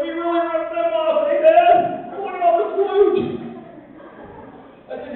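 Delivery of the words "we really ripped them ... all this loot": put-on high, gleeful kid voice; kids gloating. Kids telling Dad about their play money, in a high gleeful voice. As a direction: squeal it like a kid proud of a bargain. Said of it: "They're like".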